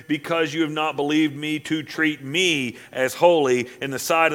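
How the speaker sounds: loud, rising scripture reading; preaching scripture. Acted. Preacher reading Numbers aloud to the church, at full force. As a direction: proclaim it to the congregation.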